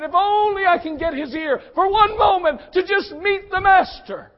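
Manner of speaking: eager and breathless, building to a cry; excited hope. Acted. Bartimaeus hearing Jesus pass by, building from eager hope to a shout. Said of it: "I can only imagine he's thinking".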